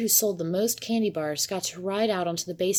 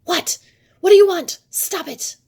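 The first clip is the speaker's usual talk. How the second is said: high, startled scream; 12 semitones higher, 12 dB louder than usual. A narrator's reading. Cat man jolted from his nap, screaming at the visitor. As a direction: scream it wide-eyed at an intruder.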